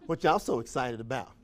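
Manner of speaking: low, soft sour question; mock gloomy put-down; gloomy sneer. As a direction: drag them down with it. Negative person mocking excitement.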